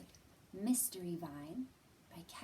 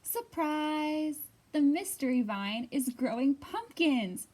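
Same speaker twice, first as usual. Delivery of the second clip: louder, lively storybook read-aloud; reading to children; 3 semitones higher, 10 dB louder, slower than usual. Acted. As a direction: read it out big and bright for a circle of kids. Reads picture book aloud.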